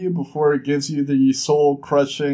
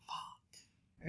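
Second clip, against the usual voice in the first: barely audible whispered curse; defeated whisper; 27 dB quieter than usual. Retold or imitated. Jazz giving in to the queen's demand, defeated whisper. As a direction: let it out under your breath, beaten. Jazz realizes she must pay.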